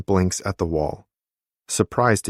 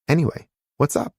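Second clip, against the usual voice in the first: quick, higher, breezy anyway; bright cheerful turn; 8 semitones higher, faster than usual. A narrator's reading. Sister breezily asks her brother what he wants, quick and higher. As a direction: toss off the question lightly and fast. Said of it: "sounding suddenly cheerful".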